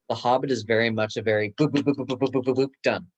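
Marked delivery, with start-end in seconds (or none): none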